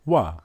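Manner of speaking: wa said short, w plus ah; wa sound. Shown as a demonstration. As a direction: model wa clearly. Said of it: "the letter is".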